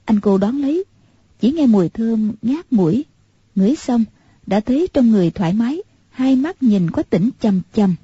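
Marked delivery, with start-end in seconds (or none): none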